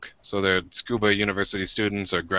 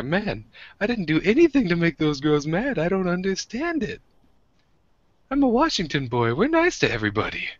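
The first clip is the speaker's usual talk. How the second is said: high-pitched, mock-plaintive protest; bewildered complaint; 10 semitones higher than usual. Retelling going home after angering the girls, bewildered and plaintive. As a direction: plead innocence in a higher voice.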